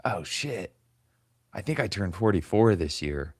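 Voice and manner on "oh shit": ordinary delivery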